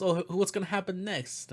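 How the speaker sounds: ordinary talk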